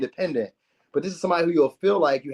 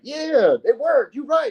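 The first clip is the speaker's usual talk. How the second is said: high, loud happy cheer; excited agreement; 11 semitones higher, 4 dB louder, slower than usual. Retold or imitated. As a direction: celebrate that it worked. Excited reaction when the idea works.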